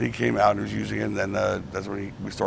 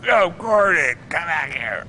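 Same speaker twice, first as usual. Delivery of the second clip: yelling; 7 semitones higher, 5 dB louder, slower than usual. Retold or imitated. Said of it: "So he goes".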